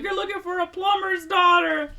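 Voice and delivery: high, loud hammed-up outburst; mock yelling. Retelling a faked married couple's fight, mock yelling. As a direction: yell it as a play-acted quarrel.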